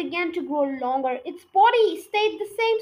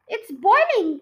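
louder, thrilled call as it bubbles; excited cry; 4 semitones higher, 7 dB louder than usual. A narrator's reading. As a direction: announce it with glee. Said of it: "cried Mister Cranky".

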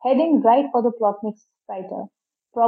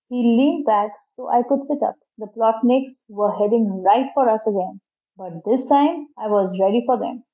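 ordinary delivery

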